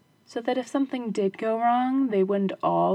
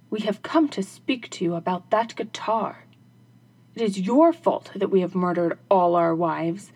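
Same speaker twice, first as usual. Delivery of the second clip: angry robbers; 4 dB louder than usual. Acted. Robbers accusing the shoemaker over their dead wives, accusing. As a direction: blame him hard.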